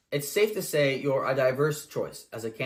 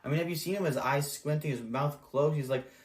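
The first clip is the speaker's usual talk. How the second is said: ordinary talk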